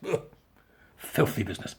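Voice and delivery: ordinary delivery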